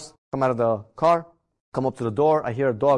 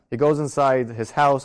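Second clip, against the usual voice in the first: ordinary talk, nothing marked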